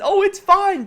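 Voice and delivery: high, loud, breezy fake calm; mock casual quote. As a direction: brush it off like nothing's wrong. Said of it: "this tweet from Jensen where he says".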